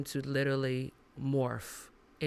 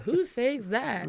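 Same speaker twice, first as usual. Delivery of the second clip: 1 mock young listener; 8 semitones higher, 4 dB louder, slower than usual. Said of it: "young ones to be like".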